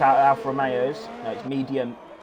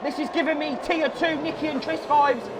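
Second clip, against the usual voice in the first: high, shouted race commentary; live play-by-play; 14 semitones higher than usual. Real, not acted. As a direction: call turn one chaos at full pitch.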